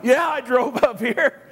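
high, slow comic retort; mock indignation. Patient to eye doctor's assistant, mock-indignant reply. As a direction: draw it out as if affronted.

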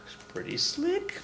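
"slick" soft and very high, drawn out; slow, hushed squeak on "slick"; sly aside. Real, not acted. Teacher admiring the blind pig trick, sly hushed aside.

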